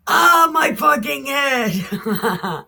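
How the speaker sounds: slow, high, loud wail, half laughing; hammed-up pained yell. Sick boy in the field crying out to his father, hammed-up yell. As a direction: overplay the headache cry.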